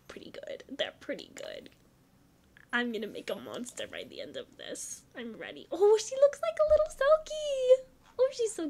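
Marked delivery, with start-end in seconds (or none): 0.1-1.7 whispered approval. Real, not acted. Quiet muttered approval. 5.7-8.7 delighted squeal. Real, not acted. Delighted at new Sim.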